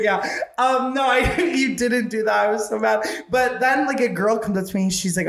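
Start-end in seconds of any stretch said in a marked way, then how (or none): none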